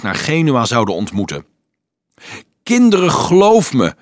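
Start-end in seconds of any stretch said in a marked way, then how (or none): 2.6-3.9 raised, higher call to a crowd. A narrator's reading. Anselmus to the grumbling children, calling out. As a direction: urgently call out to be believed. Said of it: "riep Anselmus de morrende schare toe".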